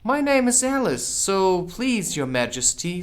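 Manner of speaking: put-on high, light girlish voice, very polite; curtsy with your voice; polite Alice. Alice answers the Queen very politely, girlish. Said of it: "said Alice very politely".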